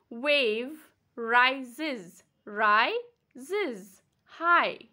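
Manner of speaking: rises broken as ri-ses; split into syllables. Shown as a demonstration. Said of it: "how many syllables we have".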